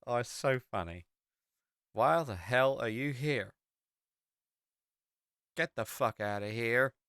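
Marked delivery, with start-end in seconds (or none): 1.9-6.9 lightly voiced country local; mock-hostile roadside local; baffled American local. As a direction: play at running a stranger off. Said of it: "the amount of people are like".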